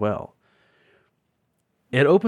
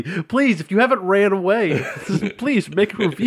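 high, loud mock pleading through laughter; mock aggressive; 9 semitones higher, 4 dB louder than usual. Co-host teasing the host's review request, mock aggressive. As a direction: imitate an over-pushy plea, cracking up. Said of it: "You said that so aggressively".

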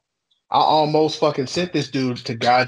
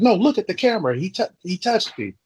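high-pitched imitation of a griping customer; mock insistent complaint; 7 semitones higher than usual. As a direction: mimic someone insisting on being wronged. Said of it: "this dude's bitching".

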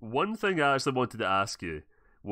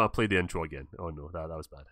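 usual speaking voice

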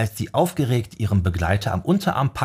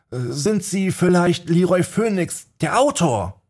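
verlegener Fan; 10 semitones higher than usual. Acted. Nervöser Fan spricht Autor an.

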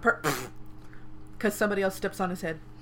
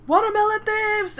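much higher, louder, slow cry of thieves; yelling; 13 semitones higher, 8 dB louder, slower than usual. Retold or imitated. Shopkeeper yelling after thieves, loud drawn-out cry. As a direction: call out after fleeing thieves.

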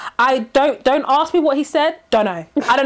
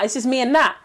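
normal talking voice

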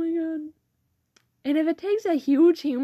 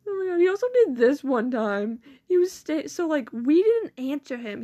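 ordinary talk, nothing marked

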